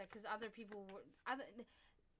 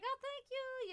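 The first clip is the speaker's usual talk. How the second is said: very high, louder grateful coo; pitched-up mock gush; gushing thanks, retold; 5 dB louder, slower than usual. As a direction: act out effusive thanks. Retells her own gushing thanks.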